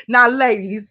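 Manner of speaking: ordinary delivery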